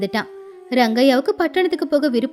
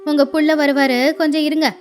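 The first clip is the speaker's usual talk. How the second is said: ordinary delivery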